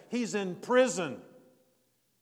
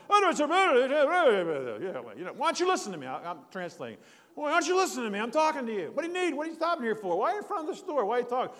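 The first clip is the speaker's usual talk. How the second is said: much higher, louder mock old man rant; old Italian man; 6 semitones higher, 6 dB louder, faster than usual. Old Italian man scolds the parked strangers, high and loud. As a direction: squawk it like a fussy old local. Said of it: "the guy's going".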